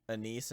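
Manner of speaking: "anise" said as uh-NEECE; alternate pronunciation. Shown as a demonstration. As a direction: stress the second syllable of "anise", with a long ee. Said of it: "was actually pronounced".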